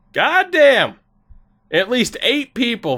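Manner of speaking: loud, high burst of amazement; excited shout. Real, not acted. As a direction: blurt it out, thrilled and stunned. Shocked by follower count.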